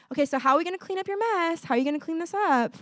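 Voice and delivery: high, quick parent voice; coaxing parent. Retold or imitated. Voices parent prompting child to tidy.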